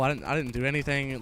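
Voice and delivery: ordinary delivery, nothing marked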